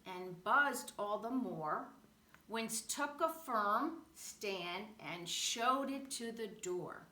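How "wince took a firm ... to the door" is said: ordinary delivery, nothing marked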